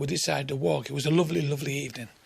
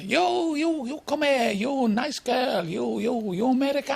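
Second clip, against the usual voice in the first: high, pushy, slow hawker call; insistent madam; 7 semitones higher, slower than usual. Retold or imitated. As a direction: insist they come in, high-pitched. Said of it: "She said".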